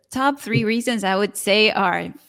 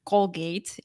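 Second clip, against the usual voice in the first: usual speaking voice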